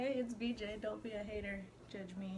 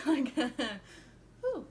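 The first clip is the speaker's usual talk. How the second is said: laughing; 4 semitones higher, 7 dB louder than usual. Real, not acted. Laughing after getting hyped.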